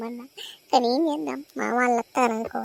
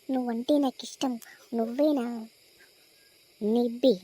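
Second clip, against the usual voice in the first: ordinary delivery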